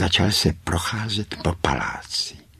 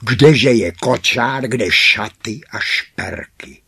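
loud, high, drawn-out nagging; scolding; 5 semitones higher, 8 dB louder than usual. A narrator's reading. Spoilt sisters demand their presents from father, loud scolding. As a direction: lay into him loudly and slowly, pitched up. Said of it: "se do něj pustili".

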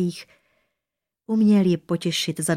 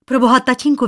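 high, loud cry of alarm; cried out; 7 semitones higher, 9 dB louder, faster than usual. A narrator's reading. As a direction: cry out in alarm to your father. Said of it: "vykřikla Korunka". (zděšený výkřik)

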